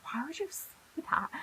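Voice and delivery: high, soft unvoiced whisper; whispered self-scolding. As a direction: hiss it at yourself in disbelief.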